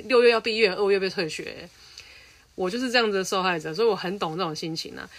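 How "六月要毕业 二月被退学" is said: indignant loud emphasis. Real, not acted. Indignant about her own expulsion.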